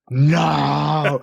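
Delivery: mock despair at being cut again; anguished no. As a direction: wail it like the news just hit. Learns scene was cut.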